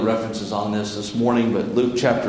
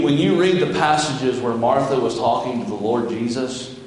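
ordinary delivery

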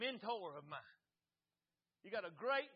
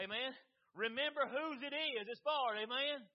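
slow, loud hammering preacher cadence; preaching; 4 semitones higher, 6 dB louder, slower than usual. Acted. Preacher pressing the church to remember, hammering. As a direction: drive each phrase home to the pews.

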